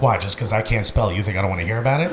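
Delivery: low offended mumble. Retold or imitated. Patient grumbling back at his doctor, low and soft. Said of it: "I'm like".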